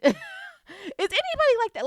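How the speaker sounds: high, excited squeal of a question. Real, not acted. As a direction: shriek the question at the crowd in disbelief. Excited rhetorical question to audience.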